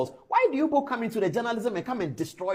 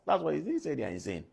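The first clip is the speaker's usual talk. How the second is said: normal talking voice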